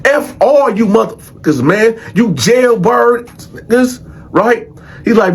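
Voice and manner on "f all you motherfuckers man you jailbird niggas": high-pitched, mock-defiant tirade; acted-out scornful boasting; talking big trash. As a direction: imitate someone cussing out the whole block on his way out. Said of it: "Jimmy just talking big shit".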